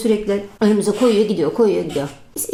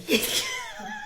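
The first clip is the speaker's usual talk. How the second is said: high squeal of laughter; 8 dB quieter than usual. Real, not acted. Laugh after sibling's answer.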